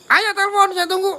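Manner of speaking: mock singsong taunt. Real, not acted. Goading viewers to phone him.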